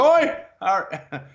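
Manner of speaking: high, loud pub holler; drunk and loud. Retold or imitated. Drunk dude spotting someone in a pub, mock holler. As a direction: imitate a loud drunk calling out. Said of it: "he drunk and loud and having fun".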